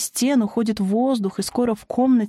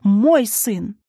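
loud, insistent cry; forceful maternal outburst; possessive yell; 5 dB louder, much slower than usual. A narrator's reading. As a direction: cry out your claim. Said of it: "завопила Аксинья".

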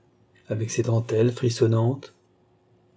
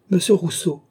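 high, raised call of recognition; exclaiming; 7 semitones higher, 4 dB louder than usual. A narrator's reading. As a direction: cry out the name on spotting him. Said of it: "s'écria madame du Barry". (exclamation vive)

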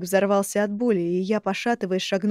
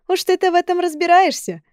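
giggling mockery; 9 semitones higher, 6 dB louder than usual. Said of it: "захихикали восемь мэров".

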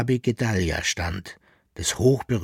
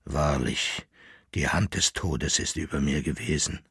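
low shaken voice; 7 semitones lower, 5 dB quieter than usual. Acted. Shaken survivor speaks.

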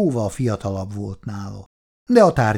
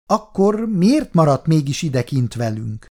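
higher, challenging question; growing bolder; 7 semitones higher, 4 dB louder than usual. A narrator's reading. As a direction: needle him with new boldness. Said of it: "lett egyre merészebb Péteri". (egyre merészebben)

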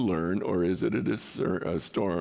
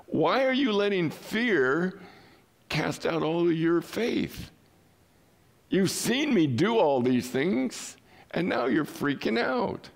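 slow, high-pitched incredulous questioning; incredulous rebuke; 9 semitones higher than usual. Acted. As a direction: reproach your followers in slow, raised disbelief. Voices Jesus questioning his disciples.

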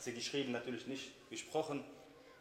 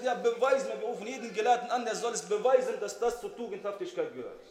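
raised, louder demand for proof; indignant challenge; 5 semitones higher, 6 dB louder than usual. Retold or imitated. Wife challenging her husband to prove it, indignant. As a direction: argue back heatedly. Wife's acted challenge in a quarrel.

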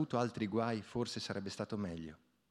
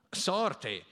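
higher, louder cry of luck; exclaiming; 7 semitones higher, 4 dB louder than usual. A narrator's reading. Pigna spotting the peasant girl, excited exclamation. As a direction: burst out at the lucky find. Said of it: "esclamò il Pigna".

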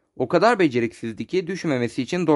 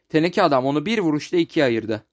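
ordinary delivery, nothing marked

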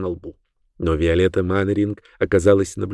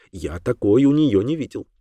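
ordinary delivery

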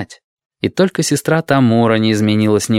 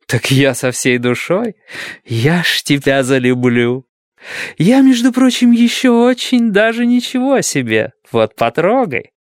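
flirty nurse; 7 semitones higher, slower than usual. Acted. Tipsy Omura flirting with Tengo.

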